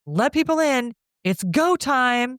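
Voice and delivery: a hint of a shouted go signal; mild mock yell. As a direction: call it over the headset like a cue. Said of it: "So he yells over the inner, his, his headset".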